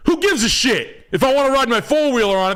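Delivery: high, fast, brash builder; mock builder. Builder defending his deck, mock brash and fast. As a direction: toss it off, cocky.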